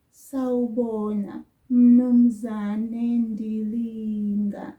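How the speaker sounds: "Mnumzane" drawn out very slowly and loud, no "u"; slow it right down so learners hear "Mnumzane"; slow greeting demo. Shown as a demonstration. Teacher repeats the greeting very slowly for learners. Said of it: "Did you hear that?".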